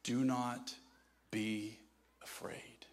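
Jesus quietly comforting Peter; gentle reassurance. Retold or imitated. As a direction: speak it tenderly into his soul. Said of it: "speaks to the deepest place of Peter's soul".

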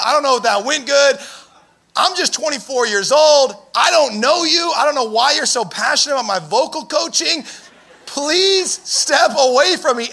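loud, high-pitched rattled comeback; defensive outburst. Acted. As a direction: push a stranger away with heated protest. Imagined outburst at unwanted critic.

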